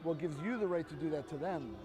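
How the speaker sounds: normal talking voice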